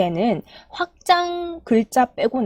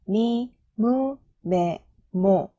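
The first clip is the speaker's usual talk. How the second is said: very slow, evenly spaced kana drill; chant-like slow row recital; syllable-by-syllable recitation; much slower than usual. Shown as a demonstration. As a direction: model each syllable for learners, held and separated. Teacher models the ma row.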